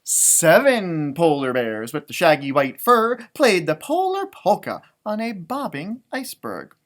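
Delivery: usual speaking voice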